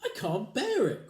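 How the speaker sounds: high, slow, loud despair; crying wife. Acted. Wife crying out in despair, high and loud. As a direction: wail it. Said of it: "cried Missus Twit".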